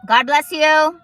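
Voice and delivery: sudden loud God bless you. Real, not acted. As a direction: call it across the room. Blessing a sneeze nearby.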